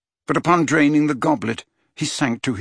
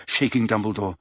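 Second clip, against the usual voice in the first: plain reading voice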